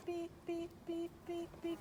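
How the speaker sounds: high, quiet beeping cue to the dog. Shown as a demonstration. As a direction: chirp the beeps softly and high, like a signal. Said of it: "when I beep, she backs up".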